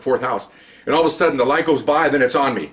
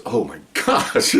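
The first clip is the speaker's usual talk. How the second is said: slow, laughing oh my gosh; laughing disbelief; 3 semitones lower, much slower than usual. Retold or imitated. Boss reacts, laughing.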